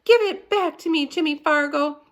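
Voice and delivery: put-on girl shrieking, near tears; screaming girl. A narrator's reading. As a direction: scream at the boy to give it back. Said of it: "Sheila screamed".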